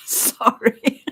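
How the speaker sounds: high laughing sorry; giggling apology. Real, not acted.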